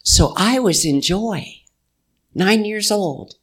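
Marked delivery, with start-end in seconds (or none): none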